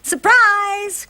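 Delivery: high, loud joyful shout of surprise; excited cry. Acted. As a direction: burst out with a big happy Surprise. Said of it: "cried her parents".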